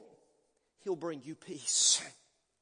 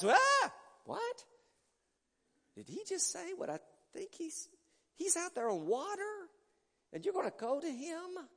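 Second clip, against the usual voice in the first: high, hushed disbelief; incredulous disciples; 10 semitones higher than usual. Retold or imitated. Disciples react to Peter's plan, high incredulous voice. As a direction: gasp it out, can't believe your ears. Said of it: "I know the guys".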